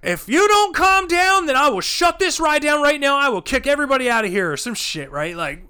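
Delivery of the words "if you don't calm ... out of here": high, loud ultimatum, speeding up at the end; imitated threatening manager. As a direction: lay down the law at full volume.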